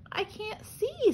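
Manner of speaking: high, slow snail voice; a small, slow snail lost in the dark; slow little snail. A narrator's reading. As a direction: drag it out like a tiny slow snail. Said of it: "said the snail".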